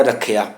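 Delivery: ਰੱਖਿਆ as rakh-ya, with a short glide; half-y pronunciation. Shown as a demonstration. Teacher stating how it must sound, half-y pronunciation. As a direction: join kh to y in ਰੱਖਿਆ. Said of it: "ਇਹਦਾ ਉਚਾਰਨ ਹੋਣਾ ਹੈ".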